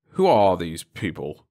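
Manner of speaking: put-on slow, stern old man's demand; a hint of a stern old man. A narrator's reading. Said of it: "he demanded".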